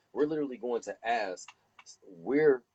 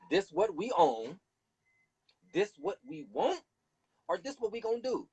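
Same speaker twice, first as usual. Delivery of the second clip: high demanding; 10 semitones higher than usual. Shown as a demonstration. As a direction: lay down the demands, high and firm. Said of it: "Nothing else to talk about".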